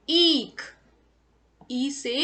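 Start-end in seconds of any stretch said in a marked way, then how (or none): 0.1-0.6 ईख said loudly with a long stretched ई; stressed long ee. Shown as a demonstration. Teacher to children, stressing the long vowel loudly. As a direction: lean on the long ई of ईख and hold it. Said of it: "बड़े ऊ को हम जोर देकर थोड़ा सा उसको खींचकर".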